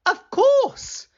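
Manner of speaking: sudden high, loud exclamation; a bright idea bursting out; exclaimed. A narrator's reading. Wife hitting on a plan, high excited exclaim. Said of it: "exclaimed Missus Grinling".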